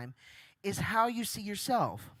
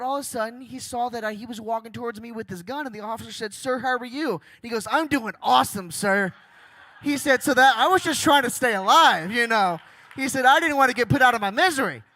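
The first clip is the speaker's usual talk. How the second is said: ramp it up louder as the joke lands; building excited punchline; 3 semitones higher, 10 dB louder than usual. Acted. Farmer in court telling the lawyer, rising to a loud punchline.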